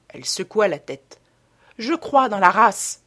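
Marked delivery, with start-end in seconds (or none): 1.8-3.0 loud, emphatic declaration; crying out. A narrator's reading. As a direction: exclaim it firmly, with extra volume. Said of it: "s'écria-t-elle".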